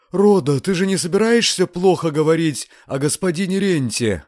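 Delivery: high, anxious woman's voice; fretful landlady coming to; alarmed landlady. A narrator's reading. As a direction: question her in high-pitched alarm. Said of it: "очнулась домохозяйка".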